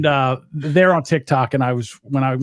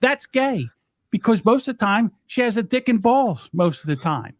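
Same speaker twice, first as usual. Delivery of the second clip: worked-up retort; 7 semitones higher than usual. Real, not acted. Arguing back at the played clip.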